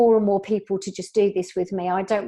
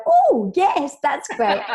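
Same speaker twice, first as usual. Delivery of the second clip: excited self-quote; 5 semitones higher, 4 dB louder, slower than usual. Retold or imitated. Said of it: "I'll go".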